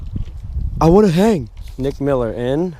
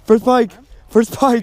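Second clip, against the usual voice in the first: excited; 7 semitones higher, 4 dB louder than usual. Real, not acted. Excited cheer over first catch.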